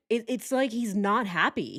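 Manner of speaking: normal talking voice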